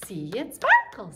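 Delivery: sparkle sung up high and loud. Shown as a demonstration. Teacher going high on the rhyme for kids. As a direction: lift your voice high on "sparkle". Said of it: "where my voice went high".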